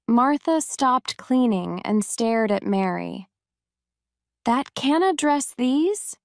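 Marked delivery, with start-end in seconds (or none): 4.4-6.2 a shocked question, pitched higher; can't believe what she just heard. Acted. Martha, amazed that Mary can't dress herself, shocked.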